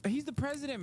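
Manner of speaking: normal talking voice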